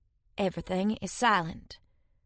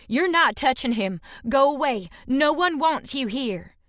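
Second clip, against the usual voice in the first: higher, fierce threat, slowing at the end; angry threat; 5 semitones higher than usual. A narrator's reading. Threatening hunters with spear.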